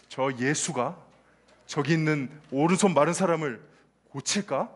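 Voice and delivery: lightly voiced suspicious crowd; suspicious whisper-like muttering. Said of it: "이러는 거예요".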